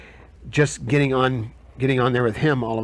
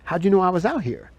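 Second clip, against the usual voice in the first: higher-pitched mimicked question; quoted woman, pitched up; Nigerian lady; 7 semitones higher than usual. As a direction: imitate the puzzled visitor in a high voice. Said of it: "She goes".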